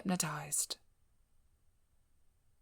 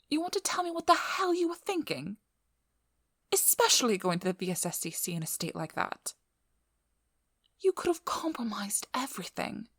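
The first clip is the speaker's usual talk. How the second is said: raised, exasperated reprimand; angry scolding; 4 semitones higher, 3 dB louder than usual. Acted. Speaker scolding a starved vampire for risking everything, angry. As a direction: tear into them for being reckless.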